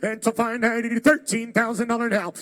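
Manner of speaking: normal talking voice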